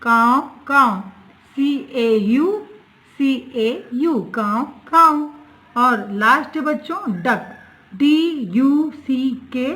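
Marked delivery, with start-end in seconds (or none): 0.0-1.0 slow, louder "काव, काव" caw; a slow, louder teacher's crow call; crow caw imitation. Shown as a demonstration. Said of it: "कौवे की आवाज़ कैसे होती है". 4.2-5.3 slow, louder "काव, काव" caw; a slow, louder teacher's crow call; crow caw imitation. Shown as a demonstration. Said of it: "कौवे की आवाज़ कैसे होती है".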